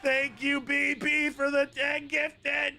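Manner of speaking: high, slow shouted hype. Real, not acted. Streamer hyping up gifter B P, high and drawn out. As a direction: drag it out like a hype man.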